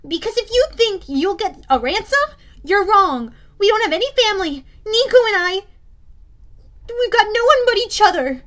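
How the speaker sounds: high, loud, heated protest; impassioned girl confronting her captor; demanding. A narrator's reading. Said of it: "Bianca demanded".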